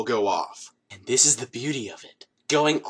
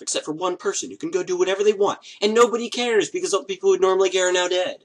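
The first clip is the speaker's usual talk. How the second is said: higher, faster and louder, racing to the punchline; manic rush; 7 semitones higher, 3 dB louder, faster than usual. Acted. Explaining the rules of the game, rushing in glee. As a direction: rattle it off gleefully. Gleefully rushing dark joke.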